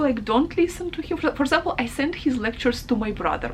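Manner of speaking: normal talking voice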